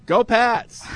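mock fan chant, pitched high; retold crowd yell. Host sums up people at the pancake house, mock yelling. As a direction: toss off an imitation cheer. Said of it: "a bunch of people in I hap yelling".

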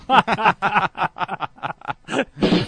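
real, drawn-out belly laugh; long burst of laughter. Real, not acted. Co-host reacts to a cheeky remark, laughing long. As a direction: burst out laughing and keep going.

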